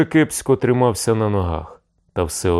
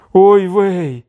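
high, loud yelp of dismay; startled cry; 12 semitones higher, 7 dB louder than usual. A narrator's reading. Tavern character seeing the hiding place, startled cry. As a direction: cry out sharply in alarm. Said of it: "скрикнула коза Дереза".

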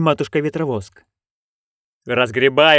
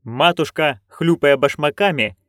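ordinary delivery, nothing marked

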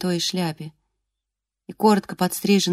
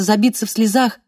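plain reading voice

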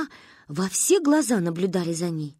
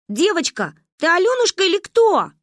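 high, louder demanding question; impatient call; 10 semitones higher, 5 dB louder than usual. A narrator's reading. Girls impatiently demand the girl's name. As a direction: call out insistently.

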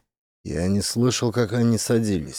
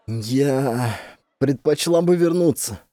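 panicked; 4 semitones higher, slower than usual. A narrator's reading. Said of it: "Теперь в её голосе слышалась паника".

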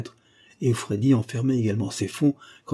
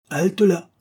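high, loud threatening command; menacing shout; 9 semitones higher, 5 dB louder than usual. A narrator's reading. Masked gunman stops his victim in bed, menacing shout. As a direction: call a sudden halt with menace. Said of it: "cria l'homme d'une voix menaçante".

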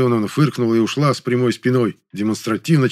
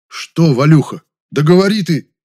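louder, higher, impatient prompting; losing patience; 6 semitones higher, 7 dB louder than usual. A narrator's reading. Man prods his mumbling friend, losing patience. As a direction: press him to get on with it. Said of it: "Я уже начал терять терпение".

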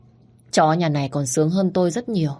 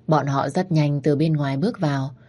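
ordinary delivery, nothing marked

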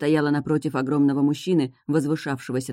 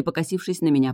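usual speaking voice

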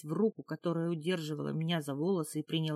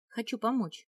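higher, sudden blurt; 6 semitones higher than usual. A narrator's reading. Captive offering help to the woman, blurting it out. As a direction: burst out with it at a raised pitch. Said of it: "выпалила я".

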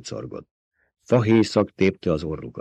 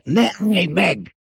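higher and slow, pressing plea; urgent repeat; 10 semitones higher, much slower than usual. A narrator's reading. Paul repeating his order to his mother, higher and slower. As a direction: stretch each word out, higher, insisting. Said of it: "ismételte meg Paul".

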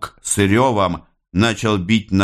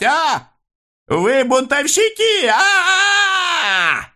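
very high, frantic harangue; hoarse, anxious shouting. A narrator's reading. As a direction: shout in alarm at the crowd while backing away. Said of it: "он кричал хрипло и тревожно". (хриплый, тревожный крик)

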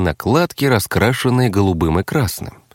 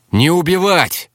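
brisk raised order; more energetic; 8 semitones higher than usual. A narrator's reading. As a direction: call it out with new vigor. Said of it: "Уже более бодро добавил я". (бодро, громче)

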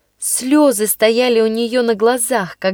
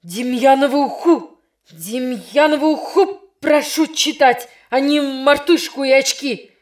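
mock frantic yell; shouting; 3 semitones higher than usual. Teacher losing control at the pupil, a mild yell. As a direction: shout in a voice not his own. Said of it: "закричал не своим голосом учитель".

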